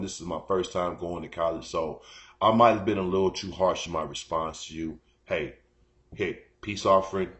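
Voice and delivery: rehearsing a soft apology to the coworker he upset; sheepish apology. Pretend. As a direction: ease in like offering a peace gift. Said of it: "go back to her girl, like".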